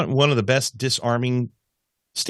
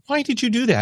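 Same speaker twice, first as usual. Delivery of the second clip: high astonished question; baffled why, voice pitched high; puzzled surprise; 12 semitones higher than usual. Retold or imitated. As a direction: ask it amazed, pitch jumping up. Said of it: "and I go".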